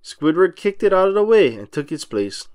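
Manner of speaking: plain reading voice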